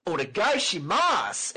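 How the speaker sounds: "Onegaishimasu!" high, slow and overdone, a hammed-up foreign accent; anime foreigner accent. Mocking how anime foreigners speak, a high, slow hammed-up accent. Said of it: "no one sounds like that in real life".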